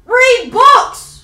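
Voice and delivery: high, loud tearful wail; crying. Real, not acted. Gamer left with nothing to play, crying wail. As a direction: wail it in despair. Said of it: "So why you crying?".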